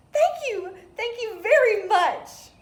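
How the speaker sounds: very high, loud squeal of thanks; little blue fish squealing thank you; overjoyed thanks. Acted. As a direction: burst out with delight.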